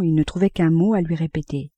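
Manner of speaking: plain reading voice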